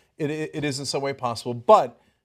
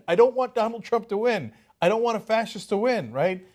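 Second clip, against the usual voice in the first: ordinary talk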